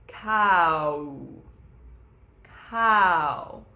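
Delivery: "cow" sung loud and slowly, stretching each vowel; "cow" drawn out from ah through to a closed oo. Shown as a demonstration. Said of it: "if I do it really slowly".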